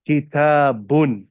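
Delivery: kitabun, slow with a stressed oo ending; Arabic ending -un. Shown as a demonstration. Teacher demonstrating the dhamma ending on kitabun slowly. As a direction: draw out the final -un of kitabun. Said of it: "The oo sound, such as".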